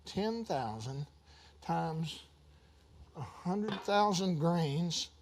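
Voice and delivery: slow, low, soft counting; reading it out. Retold or imitated. Preacher retelling his calculator sums, slow and soft. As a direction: tap it out slowly like a sum. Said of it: "I went in my calculator and I went".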